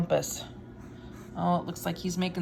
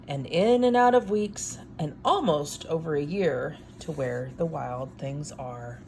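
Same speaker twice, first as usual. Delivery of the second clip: story narration; 3 semitones lower, slower than usual. A narrator's reading. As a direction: build the wild things up for the kids. Reads picture book aloud.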